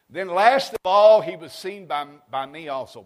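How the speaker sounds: fiery pulpit reading; preaching at full force. Acted. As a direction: proclaim each word to the congregation.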